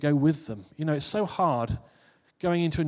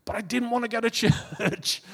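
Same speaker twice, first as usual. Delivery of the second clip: laughing; 8 semitones higher than usual. Real, not acted. Recalling reluctance, laughing.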